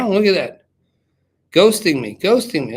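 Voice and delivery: loud mock outrage at being ignored; playful indignant. Real, not acted. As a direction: call it out, teasing and loud. Friend not picking up the call.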